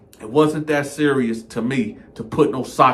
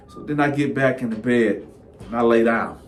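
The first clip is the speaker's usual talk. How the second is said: normal talking voice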